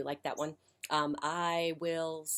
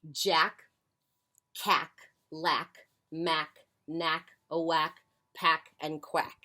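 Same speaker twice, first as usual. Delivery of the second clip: names spaced out with pauses; measured, deliberate roll call; slow listing; 5 dB louder, slower than usual. Shown as a demonstration. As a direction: recite the names slowly, one by one. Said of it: "say them one more time".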